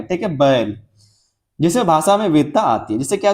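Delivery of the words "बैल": बैल as a drawn-out ba-el; slight change of sound inside बैल; combined vowel. Shown as a demonstration. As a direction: glide through the ऐ of बैल. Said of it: "बै से शुरू किए थे".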